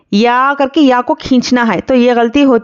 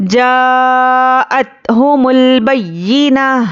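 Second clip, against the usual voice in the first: slow, melodic tajweed recitation; measured Quranic chant; much slower than usual. Shown as a demonstration. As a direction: chant it slowly like a qari. Recites verse fragment.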